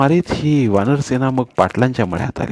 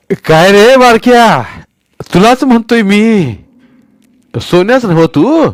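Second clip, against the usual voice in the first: put-on much higher, much louder, slow old man's call; old Patil's voice; 11 semitones higher, 9 dB louder, slower than usual. A narrator's reading. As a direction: boom down at the little boy from the well. Patil questions Sonya.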